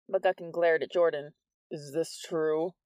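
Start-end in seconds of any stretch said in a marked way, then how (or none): 1.7-2.7 lightly voiced stern demand; menacing principal. A narrator's reading.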